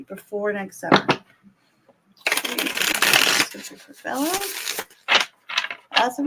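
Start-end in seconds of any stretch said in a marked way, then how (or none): 2.3-4.4 whispered invocation; whispering. Acted. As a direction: breathe the words without voice.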